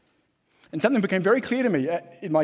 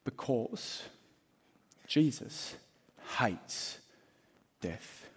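much softer and lower, slow with pauses between each word; hushed emphasis; 4 semitones lower, 8 dB quieter, much slower than usual. Acted. Preacher landing his main point in a grave hush. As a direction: drop to a grave hush on hates death.

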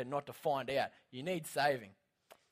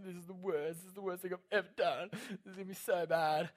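mock freaking out; 3 semitones higher, 3 dB quieter than usual. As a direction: fret to yourself in a put-on mild panic. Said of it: "And I'm just freaking out".

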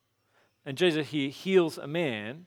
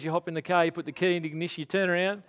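ordinary talk, nothing marked

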